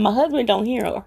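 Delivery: usual speaking voice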